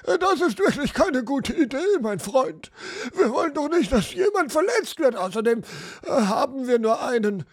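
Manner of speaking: put-on high, flustered old man's pleading; nervous old showman. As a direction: talk the giant down in a fluster. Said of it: "älterer Schausteller entsetzt und eilte hektisch herbei".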